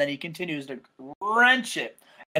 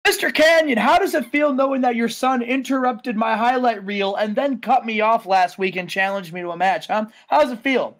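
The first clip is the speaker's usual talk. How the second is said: Jericho; 5 semitones higher than usual. Acted. Wrestler interviews rival's beaten dad, taunting. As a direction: grill him like a heel wrestler. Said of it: "And he says".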